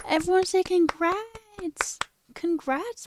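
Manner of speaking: high, drawn-out congrats; delighted high-pitched congratulations; excited cheer. Real, not acted. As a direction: cheer them on, high and slow. Cheering a friend's new job.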